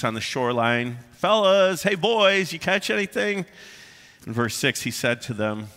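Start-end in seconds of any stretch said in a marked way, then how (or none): 1.1-3.5 warm inviting call. Retold or imitated. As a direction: friendly call out across the water, pitched higher and louder. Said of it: "this soft, warm, inviting cry out from Jesus".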